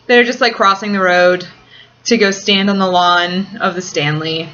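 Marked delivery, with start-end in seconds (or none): none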